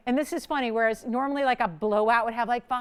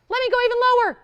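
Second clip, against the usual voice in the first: much higher and louder, pushing the deal; hyped price drop; 12 semitones higher, 7 dB louder, much faster than usual. Real, not acted. Host slashing the price for viewers, hyped.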